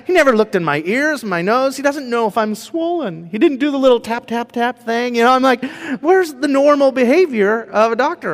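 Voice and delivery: venting at the careless doctor, high and pushed; mild frustration. Retold or imitated. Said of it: "I was a little frustrated".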